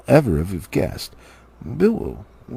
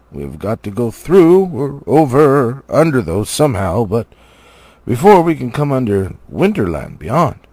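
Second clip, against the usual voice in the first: louder, firm dwarf reply; gruff dwarf Balin, firm; 3 semitones higher, 5 dB louder than usual. Acted. Dwarf Balin answering Bilbo, firm and loud. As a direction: set the hobbit straight, firmly.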